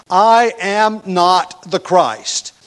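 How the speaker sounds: each word slow, loud and weighted; emphatic testimony. Retold or imitated. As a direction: declare it with full emphasis, word by word. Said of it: "He makes the emphatic testimony".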